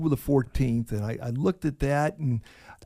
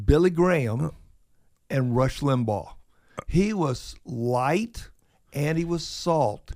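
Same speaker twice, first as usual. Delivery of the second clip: ordinary talk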